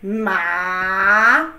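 Ma dipping low then up; third tone ma. Shown as a demonstration. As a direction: dip Ma down low and back.

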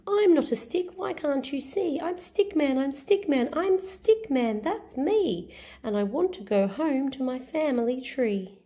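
high, sing-song little stick hero; Stick Man voice. Acted. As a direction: chant the refrain brightly, stretched out. Stick Man's refrain.

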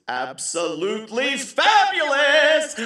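high, loud, drawn-out "fabulous"; flamboyant gushing. Acted. Comic describing the bar's decor, flamboyant gushing. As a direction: swoop up and belt it out, stretched.

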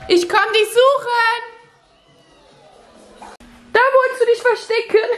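calling out. Real, not acted. Calling out to hidden child.

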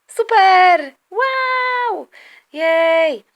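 high, drawn-out cheers; loud, high boyish celebration; excited cheering. Acted. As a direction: whoop with delight, each word held long. Boy cheering at accepted invitation.

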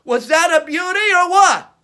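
loud showman hype; excited showman. Acted. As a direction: hype up the crowd loudly. Showman hyping the crowd.

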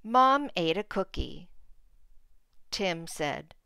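put-on clear, expressive read-aloud for small kids; reading to children. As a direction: read the picture book to the class. Teacher reads picture book aloud.

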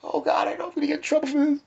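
high, loud, fast panicky babble; mock panic. Born-again kid listening to Iron Maiden, fast panicky squeal. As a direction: gabble like a scared kid expecting punishment. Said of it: "I'd be like".

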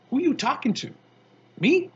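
mock innocence. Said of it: "I says to him".

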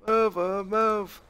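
shouting orders over battle. Real, not acted. Urging units in hectic game.

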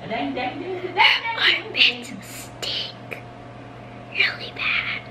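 hushed whisper to the camera. Real, not acted. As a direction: whisper so she won't hear.

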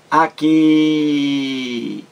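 "aqui" with a long-held final "qui"; teacher stretching the "qui" in "aqui"; stress on last syllable. Shown as a demonstration. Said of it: "A sílaba mais forte é a última". ("aqui" com o "qui" final bem prolongado)

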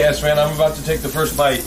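normal talking voice

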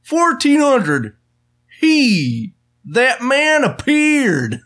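slow, high, dramatic announcer read; drawn-out TV host delivery; Doctor Phil voice. Pretend. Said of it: "it kinda did sound like Doctor Phil".

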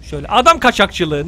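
usual speaking voice

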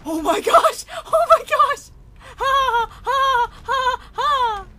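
loud, high laughing shriek; shrieking laughter. Real, not acted. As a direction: crack up at the ragdoll. Laughing at floppy corpse.

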